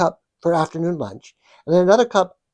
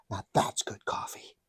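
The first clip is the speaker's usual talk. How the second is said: satisfied whisper; 8 semitones lower, 10 dB quieter than usual. Real, not acted. Savouring a sip of coffee.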